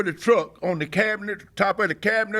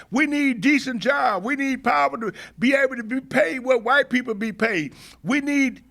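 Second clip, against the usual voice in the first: higher-pitched, emphatic re-enactment of a rally speech; 6 semitones higher than usual. Retold or imitated. Activist re-enacting his speech to marchers, impassioned rally delivery. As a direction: drive each "we need" home like a speaker rousing a crowd. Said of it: "I said in my speech".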